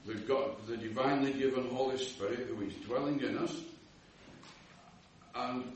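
usual speaking voice

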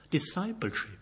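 usual speaking voice

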